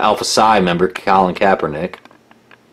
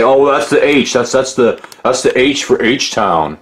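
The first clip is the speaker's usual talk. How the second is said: raised pitch, imitating a defensive fan; mock excuse-making; 5 semitones higher than usual. Imitating people who insist it's for H-Town, mock excuse-making. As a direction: put on a higher voice for a feeble excuse.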